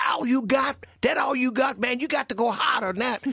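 high-pitched, shouted taunt; imitating a defiant boxer mid-fight; Muhammad Ali. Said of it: "Ali was saying".

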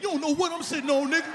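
high-pitched mock bragging; hammed-up high swagger; cocky boast. Shown as a demonstration. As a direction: brag defensively with the voice pushed up. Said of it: "when you say it".

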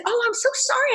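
very high, sweet-talking apology; high-pitched retold sorry; apologetic. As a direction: imitate an appeasing apology in a high voice. Retold apology to angry customer.

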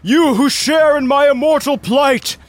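high, booming incantation; slow, theatrical villain shout; Nalar's shouted summons. Acted. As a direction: call out grandly to summon an ally. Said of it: "he raises up his head and he shouts".

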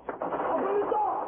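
very high frantic scream; panicked scream. Acted. Prisoner fleeing the detective's approach, panicked scream. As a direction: shriek for the door to open. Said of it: "he screamed again".

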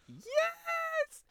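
mock elated yes. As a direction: act out over-keen delight. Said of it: "I'll be like".

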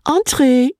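high, clear little lady's call; small high-pitched invitation. A narrator's reading. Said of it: "said a clear little voice".

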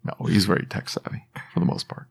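hushed mock-serious aside; whisper. Real, not acted. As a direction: whisper it like a secret. Whispered aside about Dutch.

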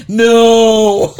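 loud, high, drawn-out comic no; mock horrified cry. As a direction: wail it in exaggerated horror, half laughing. Acting out horror at the cost.

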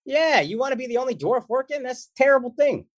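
high, mock-outraged voice; hammed-up outrage at a rival actor, mock indignant; mock indignant rant. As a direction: pretend outrage on the dwarfs' behalf. Mock rant at Peter Dinklage.

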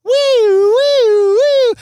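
high loud siren wail; police siren imitation. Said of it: "I am full of the impressions today".

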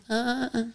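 soft, breathy imitated moaning; mother's moans.

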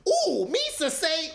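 high, slow Gungan squeal; mock Jar Jar, very high; high, slow Gungan imitation. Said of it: "we was all like".

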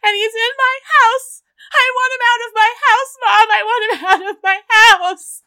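loud, high tantrum to mom; hammed-up daughter shrieking down the phone; mock hysterical wail. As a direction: wail your outrage at full pitch. Wife wails to mom from jail.

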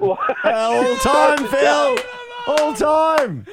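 loud, high whoop of approval; excited shout. Real, not acted. Host cheering a caller's story, excited shout. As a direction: roar it out, thrilled.

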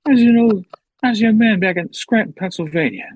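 high, loud old politician impression; Joe Biden. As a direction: burst out like an indignant ageing president. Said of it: "that's my Biden".

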